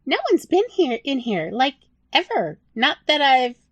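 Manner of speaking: a tiny creature stating the obvious; high squeaky rat, very matter-of-fact. Acted. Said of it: "said very matter-of-factly".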